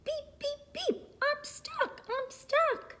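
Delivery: squeaky, high little-truck voice; crying out. A narrator's reading. Stuck Little Blue Truck calls for rescue, crying out. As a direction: plead in a tiny high voice. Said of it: "cried the Little Blue Truck".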